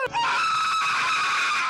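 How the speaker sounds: high shrieking scream; little girl scream. Retold or imitated. Scared by a spider, a girlish shriek. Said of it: "screamed like a little girl".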